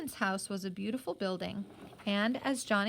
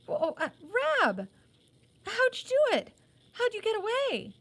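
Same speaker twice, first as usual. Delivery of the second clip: put-on much higher, louder boy's voice, bursting with relief; breathless excitement; 13 semitones higher, 4 dB louder than usual. A narrator's reading. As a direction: rush the questions out, high-pitched and eager. Johnny bursts in on Rab.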